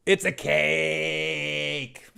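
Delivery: very slow, drawn-out quoting; Deep Space Nine quote. Retold or imitated. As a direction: stretch every word of the quote. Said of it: "Deep Space Nine fans will understand".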